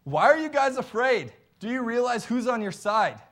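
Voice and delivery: high, loud rebuke of cowards; indignant shout. Retold or imitated. David scolding the frightened Israelites, loud and high. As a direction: call them out, voice up and loud. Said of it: "So David's saying".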